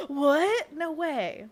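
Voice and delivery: gasp, then high mock disbelief; feigned surprise. Streamer showing her friend how to react, mock surprise. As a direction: play shocked as if it's news. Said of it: "you're supposed to be like".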